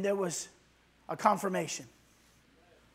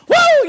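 very high, loud shout of joy; joyful whoop; 16 dB louder than usual. Acted. Preacher acting out joy at a healing, whooping. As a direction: let out a burst of delight. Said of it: "joyful whoop mid-sermon".

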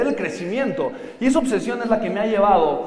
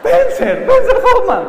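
high, loud, squeaky Pencer; mocking mispronunciation; 8 dB louder, slower than usual. Speaker imitating people who call him Pencer, high mock squeal. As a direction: squeal the name like a joke. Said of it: "cuando me presentan como Pencer".

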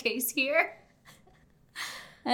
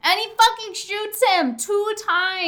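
high, loud, excited yell; dramatic climax; 10 semitones higher, 12 dB louder than usual. Retold or imitated. Co-host retelling the train shooting, excited dramatic yell. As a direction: belt out the shocking twist.

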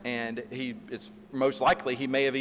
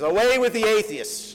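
much higher and louder, hurled back at the crowd; defiant retort; 9 semitones higher, 6 dB louder than usual. Retold or imitated. Polycarp turning the charge on the crowd, defiant loud retort. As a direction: throw the words back at the pagans. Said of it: "he says".

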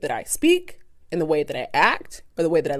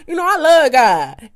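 higher, louder, drawn-out mock declaration; showy piety; 7 semitones higher, 6 dB louder than usual. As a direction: proclaim it for show. Acts out a showy churchgoer.

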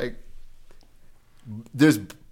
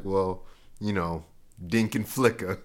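low laid-back voice; 8 semitones lower, 7 dB quieter than usual. Retold or imitated. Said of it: "he was like".

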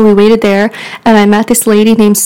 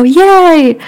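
much higher cheering yay; mock cheer; 7 semitones higher, 4 dB louder than usual. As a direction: squeal it like happy kids. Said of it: "They'd go".

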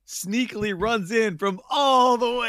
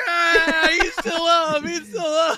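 high, shouted mock crowd cheer; hammed-up arena shout; 10 semitones higher, 4 dB louder, much slower than usual. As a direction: roar him back to life like the fans. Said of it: "and they're like".

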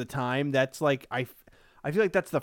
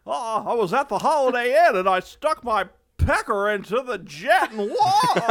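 Jimmy Stewart impression; 9 semitones higher, 8 dB louder than usual. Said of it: "Do it".

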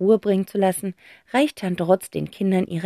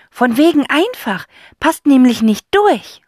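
put-on boy voice concluding; 6 semitones higher, 8 dB louder than usual. Zack concluding.

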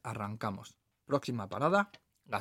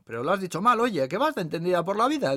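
raised, louder voice of a lady correcting; scolding know-it-all; 6 semitones higher, 6 dB louder than usual. Acted. Lady at next table correcting the waitress, scolding. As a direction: butt in and correct her, pitched up.

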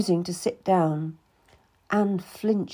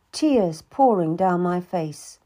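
ordinary delivery, nothing marked

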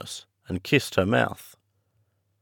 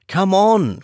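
high, louder urgent call; urgent cry; 10 semitones higher, 5 dB louder than usual. A narrator's reading. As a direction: hurry her away from danger. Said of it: "cried John".

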